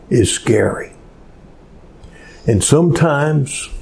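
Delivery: normal talking voice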